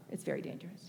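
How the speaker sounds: "very dangerous" dropped to a whisper; whispered repeat. Shown as a demonstration. Warning the audience, whispering "very dangerous". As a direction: hush it the second time, "It's very dangerous". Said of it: "Yeah, it's very dangerous where we are".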